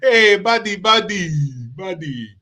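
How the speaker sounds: put-on, high new New York accent, fading away; mock the new New York accent. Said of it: "the new New York accent is".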